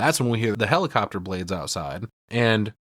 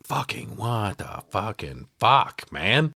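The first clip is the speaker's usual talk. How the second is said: slow disgusted disbelief; much slower than usual. Real, not acted. Disgust at shrine.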